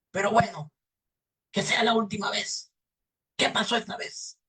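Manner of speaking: high, strained devil voice; grudging devil. Acted. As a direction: relent with a last warning. Devil relents and asks.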